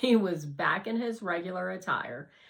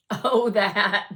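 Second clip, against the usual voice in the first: chuckling, louder; laughing; 2 semitones higher, 11 dB louder than usual. A narrator's reading. Harlan laughing it off.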